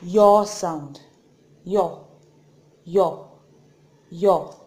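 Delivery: "Yo" said clearly, repeated; Korean vowel Yo. Shown as a demonstration. Teacher drilling "Yo" for students. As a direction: model "Yo" crisply. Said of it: "This is".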